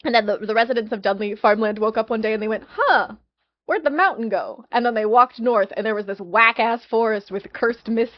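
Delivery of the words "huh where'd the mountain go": higher, louder mock bewilderment; a baffled farmer scratching his head; mock puzzled villager. Farmland residents waking to a missing mountain, higher mock puzzlement.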